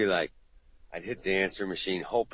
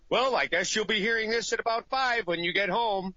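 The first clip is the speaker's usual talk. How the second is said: much higher sarcastic voicemail; mock resigned caller; 12 semitones higher than usual. Client's sarcastic voicemail after five messages. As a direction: sigh it out sarcastically.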